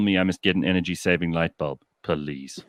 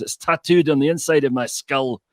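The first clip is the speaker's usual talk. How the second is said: Billy Connolly Scottish; 5 semitones higher, 4 dB louder than usual. Retold or imitated. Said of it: "story from Billy Connolly".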